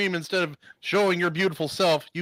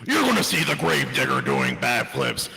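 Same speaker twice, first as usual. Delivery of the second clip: slow, deep, booming radio ad; monster truck ad; 4 semitones lower, slower than usual. Acted.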